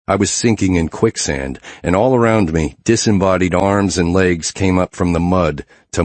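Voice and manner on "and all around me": ordinary delivery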